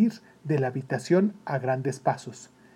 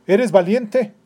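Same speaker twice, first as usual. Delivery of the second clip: loud, higher, demanding question; stern captain; 6 semitones higher, 9 dB louder, slower than usual. Acted. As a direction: test the boy's courage, loudly. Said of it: "el capitán le preguntó".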